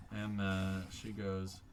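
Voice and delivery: normal talking voice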